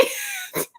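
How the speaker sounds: a sudden high squeaky giggle. Real, not acted. Streamer giggling at her own Smallville joke, high giggle. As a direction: burst into a high little laugh.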